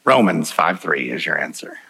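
a hint of a low, calm voice of God; understated divine voice; voice of the Lord. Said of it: "the Lord said".

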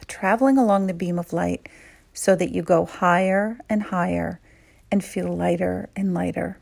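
calm, unhurried meditation voice; guided meditation. Acted. Guide leading women leaders through a visualization, calm and soothing. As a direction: lead them gently inward, pausing often.

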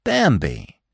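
calling his name in gentle reproach; a reproachful but sure call; reproachful cry. A narrator's reading. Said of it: "he cried reproachfully".

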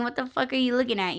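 ordinary talk

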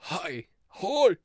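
halting old-man "Hi"s, lightly imitated; a weak Joe Biden greeting; bad Biden impression hellos. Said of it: "I'm trying to do Joe Biden".

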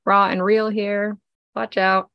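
ordinary talk

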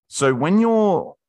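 jumps up loud and high, drawn out; sudden loud high outburst. Real, not acted. Speaker to audience, suddenly loud and high. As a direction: bursts out to grab attention.

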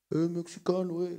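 lightly imitated American bartender accent; mock American accent. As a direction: put on a slight American accent. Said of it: "Es como hablan esos güeyes". (imitando un leve acento estadounidense)

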